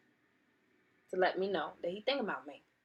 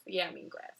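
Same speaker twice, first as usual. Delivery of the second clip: ordinary talk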